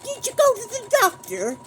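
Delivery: high, loud questioning call; calling out to the dog about the doctor; mock shout. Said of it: "And so I says to him".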